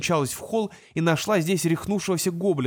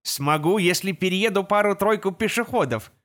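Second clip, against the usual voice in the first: computer navigator voice; gps voice; 4 semitones higher than usual. Acted. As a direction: talk like the van's navigator. Navigator answers Жеребкинс.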